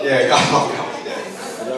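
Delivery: chuckling through the words; laughing. Real, not acted. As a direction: laugh while you say it. Laughs at own confession.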